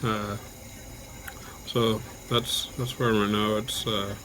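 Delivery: usual speaking voice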